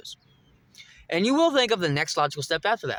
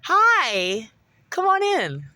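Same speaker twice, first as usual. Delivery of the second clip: sugary, much higher hostess voice; fake sweet welcome; 9 semitones higher, 4 dB louder, much slower than usual. Imitating his possessed mom at the door, falsely sweet. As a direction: lure him in with a sweet, too-high greeting. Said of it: "You gotta smile".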